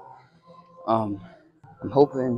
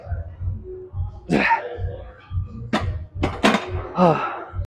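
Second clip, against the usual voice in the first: long, high, breathy release of breath; long exhausted sigh; 7 semitones higher than usual. Real, not acted. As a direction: let it all out after the set. Finishing last set.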